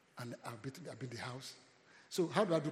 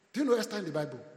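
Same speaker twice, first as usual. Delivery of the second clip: put-on calling out to kids; 6 semitones higher, 11 dB louder, faster than usual.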